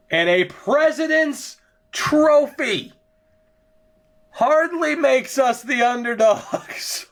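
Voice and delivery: mock modest sarcasm. Sarcastic boast about top record.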